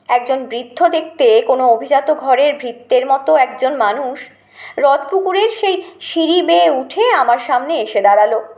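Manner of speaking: usual speaking voice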